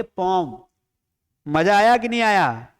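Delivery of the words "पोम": पोम as one syllable, "pome"; पोम with no y glide; taught reading of poem. Shown as a demonstration. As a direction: say पोम rhyming with "home". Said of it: "पोयम को क्या बोलेंगे".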